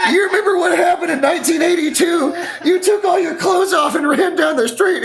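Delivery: high, loud mock-scolding wife voice. Retelling the wife scolding Harold about 1982, high loud screech. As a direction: nag him in a high laughing screech. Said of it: "She's like".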